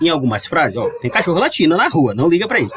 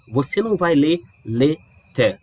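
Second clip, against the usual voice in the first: ordinary delivery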